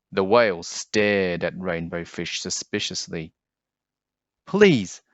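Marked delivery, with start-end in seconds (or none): 4.4-5.0 high, loud earnest plea; pleading. Acted. Rainbow Fish pleading with the whale, high and earnest. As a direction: beg the whale to talk, voice pitched up. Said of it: "said Rainbow Fish".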